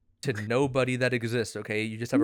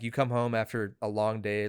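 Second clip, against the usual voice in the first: ordinary delivery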